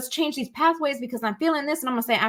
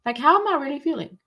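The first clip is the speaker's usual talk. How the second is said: gentle self-check; 8 semitones higher, faster than usual. Shown as a demonstration. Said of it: "ask yourself".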